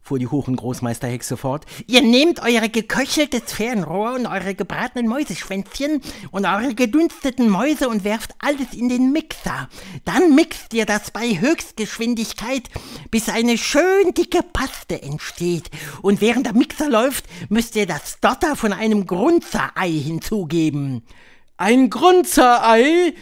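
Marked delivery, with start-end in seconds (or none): 1.9-21.0 high, loud witch voice; high-pitched, theatrical witch leader; Grand High Witch. A narrator's reading. 21.5-23.1 high, loud, drawn-out question; shrieked witch question; shrieking. A narrator's reading. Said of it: "kreischten die Hexen".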